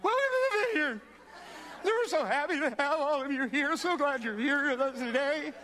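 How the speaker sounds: very high, loud, hammed-up panicky welcome; frantically over-cheery, imitating his own nerves; nervous. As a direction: squeal a greeting in mock terror. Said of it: "my hands are shaking".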